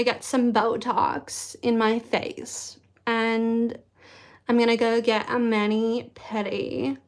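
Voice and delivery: slow, drawn-out LA influencer; LA influencer accent. Shown as a demonstration. Influencer chatting about life in LA, in a slow LA accent. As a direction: stretch the words like an LA influencer. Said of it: "like, the L A influencer".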